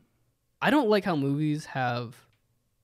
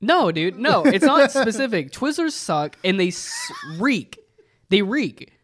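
ranting; 7 semitones higher, 4 dB louder than usual. Real, not acted. Ranting about Twizzlers.

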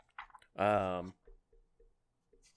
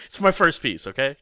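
laughing; 8 semitones higher, 6 dB louder than usual. Acted. Laughing blogger impression.

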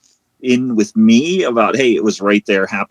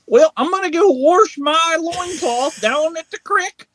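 high, slow, drawn-out West Virginia twang; West Virginia parody; slower than usual. Acted. Mothman announcing his trip to the creek, broad West Virginia accent. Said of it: "a West Virginia accent".

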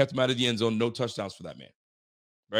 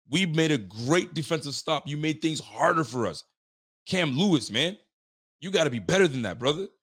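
normal talking voice

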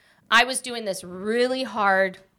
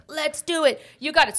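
lightly imitated rallying coach, higher pitch; a touch of a pumped-up trainer, pitched up; coach turned cheerleader; 5 semitones higher than usual. Coach urging her through the last sets, lightly imitated rallying. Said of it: "then he turns into this different person".

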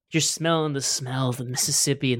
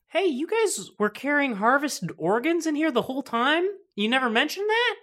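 high-pitched incredulous doctor; 10 semitones higher than usual. Acted. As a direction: ask it high and disbelieving, Doctor Ba. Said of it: "Doctor Ba walks up behind you and says".

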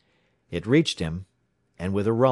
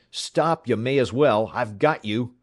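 loud, raised-pitch demand to surrender; shouting; 4 semitones higher, 6 dB louder than usual. A narrator's reading. Pursuer closing on the two fleeing riders, shouting. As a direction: shout at them to give up. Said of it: "he shouted".

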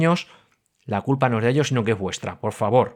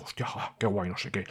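hushed, softer whisper of delight; impressed whisper; 6 semitones lower, 13 dB quieter than usual. Retold or imitated. Diner whispers over a fancy dish, hushed and impressed. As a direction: whisper it like you can't believe your luck. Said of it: "Y tú".

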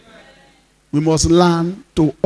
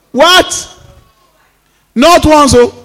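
incredulous shout; 9 semitones higher, 6 dB louder, slower than usual. Real, not acted. Preacher exclaims at Moses striking twice.

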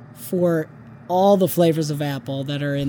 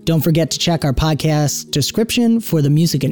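podcast outro read; 4 semitones lower than usual. Acted.

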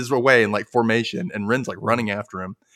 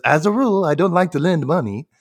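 louder, higher cartoon character voice; put-on cartoon voice, pitched up and projected; Ren; 6 semitones higher, 5 dB louder than usual. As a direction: play a cartoon character holding forth. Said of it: "Ren says".

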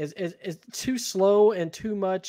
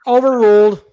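loud, raised courtroom ruling; mock judge; 7 semitones higher, 13 dB louder than usual. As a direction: boom it out like a judge. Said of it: "Why do they change up the tones?".

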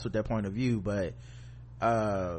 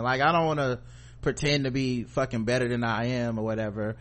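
ordinary delivery

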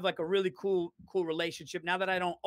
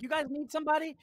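eager manager; 9 semitones higher, faster than usual. Retold or imitated. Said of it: "he's like".

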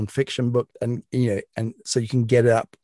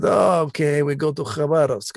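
put-on Russian accent, higher, giving in; Russian minder relenting; 5 semitones higher than usual. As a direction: give way in a light Russian accent, pitched up. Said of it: "He said".

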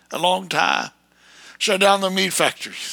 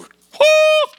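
very high, loud woo; a taunting whoop; jeering cheer; mocking whoop; 6 dB louder than usual. Real, not acted.

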